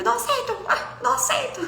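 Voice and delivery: put-on high-pitched childish protest; mock tantrum, squealed in refusal; whiny, hammed-up digging in of heels; stubborn refusal.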